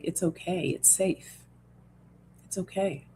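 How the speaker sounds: soft, hushed reassurance; gentle calming whisper-soft voice; soothing self-talk. Shown as a demonstration. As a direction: quietly comfort your own body. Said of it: "we gotta tell our bodies".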